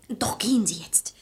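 whispered urging. Acted. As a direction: hurry them off in a whisper. Urging secretly to leave.

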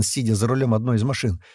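plain reading voice

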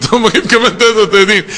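fast, through a laugh; amused mock counting. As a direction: laugh at the habit while saying it. Mocking rote counting of dhikr.

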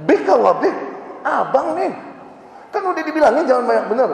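high, fast nagging scold; scolding wife. Retold or imitated. Wife scolding Jabir for inviting too many, nagging. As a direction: tell off your husband.

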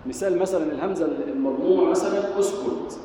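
normal talking voice

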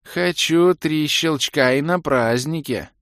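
high slow offended elf; sulky whine. Acted. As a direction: whine like a pouting child. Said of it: "обиженно пояснил Орбит". (обиженное нытьё)

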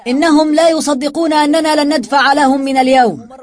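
high, projected rallying call; loud address. A narrator's reading. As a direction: shout out to a crowd. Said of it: "تحدث بصوت عال إلى العمال".

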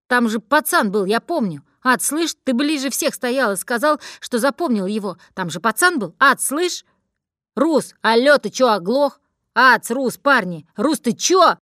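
panicking, shaking his friends awake; frantic calling. Acted. Panicked gang member calling friends. (испуганно, громко зовёт друзей)

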